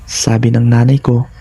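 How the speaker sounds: ordinary delivery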